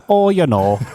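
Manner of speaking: put-on broad Wisconsin accent, high and loud; hammed-up Wisconsin "Oh, you know". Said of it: "stereotypical Wisconsin accent on it".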